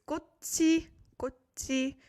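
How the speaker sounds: "gochi" with a clear ch before the ee; original-form pronunciation. Shown as a demonstration. Teacher showing learners the word with a vowel after, "gochi" with ch. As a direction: say "gochi", sounding the final consonant as ch. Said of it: "so it's pronounced as gochi".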